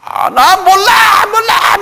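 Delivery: very high, drawn-out wail of protest; shouted denial. Acted. Peter insisting to the crowd he knows nothing, shouting. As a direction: yell it to shake them off.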